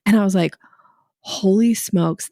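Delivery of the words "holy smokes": slow, stretched-out holy smokes; a lightly retold wow; drawn-out amazement. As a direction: savor each word in mock awe. Retold amazement at coach's idea.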